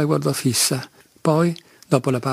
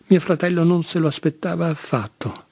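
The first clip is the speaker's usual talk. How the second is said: ordinary delivery, nothing marked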